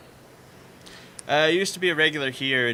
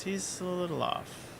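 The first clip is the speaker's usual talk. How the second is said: soft, higher-pitched aside; confiding aside; 7 semitones higher, 8 dB quieter than usual. Acted. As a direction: say it under your breath, as a discreet confidence. Bartender confides about a crazy patron.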